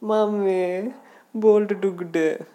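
high, drawn-out tattletale call; tattling Brutus. Acted. Blaming the dog to mom, tattling. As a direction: whine loudly up to mom, stretching it out. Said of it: "I'll sound like Brutus".

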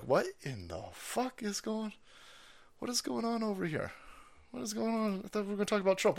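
high, hushed confusion; bewildered viewer. Retold or imitated. Newly arrived viewers wondering aloud, hushed mock bewilderment.

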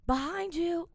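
high, sing-out warning call, not full volume; imitated kitchen yell. Customer imitating staff heard at Texas Roadhouse, mock yelling. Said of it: "just, like, yelling stuff".

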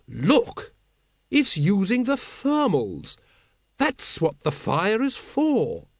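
lightly voiced noblewoman lost in awe; a hint of an enraptured aristocrat; enthralled noblewoman. A narrator's reading. Lady Ramkin enthralled by dragon.

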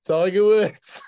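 loud, high burst of the name; excited blurt. Real, not acted. To his co-host, blurting the name out loud and high. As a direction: shout the answer out as it hits you. Blurts the real answer, laughing.

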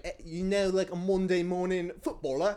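high, loud mock-English accent. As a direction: put on a hammy English accent. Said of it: "that's a very English accent I'm doing".

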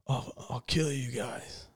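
low, soft, flat mock threat; Keanu impression. Mocking Keanu's line reading, low mumbled threat. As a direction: mumble the threat like a bored hitman. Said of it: "no one talks like that".

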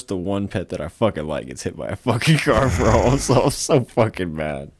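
ordinary delivery

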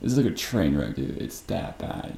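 normal talking voice

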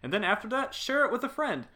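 hammed-up excited ad read; mock podcast plug. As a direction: oversell the five-star review like a promo. Hyped podcast plug parody.

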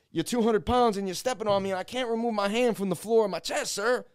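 high, urgent retold plea; strained protest. Suspect with a heavy cop on his back, strained protest.

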